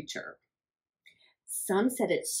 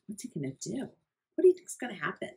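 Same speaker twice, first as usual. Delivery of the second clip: usual speaking voice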